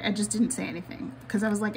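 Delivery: ordinary talk, nothing marked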